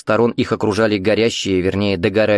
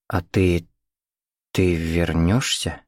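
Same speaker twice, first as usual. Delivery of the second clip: slowly; 2 semitones lower, 3 dB quieter, much slower than usual. Acted. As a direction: draw out each word, unsure. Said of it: "медленно проговорила Катя".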